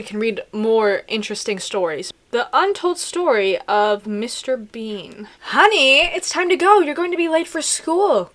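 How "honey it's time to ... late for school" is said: mum calling out in a raised voice; high, loud call to a child; mother calling. A narrator's reading. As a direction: call out like a mother hurrying her kid. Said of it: "called Mother".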